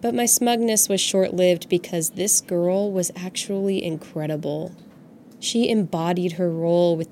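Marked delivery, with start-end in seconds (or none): none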